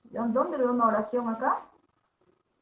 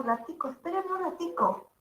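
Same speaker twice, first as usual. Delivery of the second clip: light Venezuelan lilt, raised pitch; a soft Venezuelan ratico; Venezuelan accent; 6 semitones higher, 7 dB quieter than usual. Shown as a demonstration. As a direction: Venezuelan casual phrasing, higher and softer. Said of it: "En Venezuela dicen ico".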